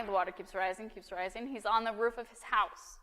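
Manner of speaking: ordinary talk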